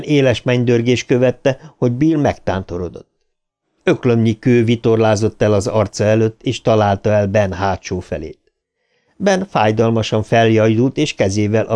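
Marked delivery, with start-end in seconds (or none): none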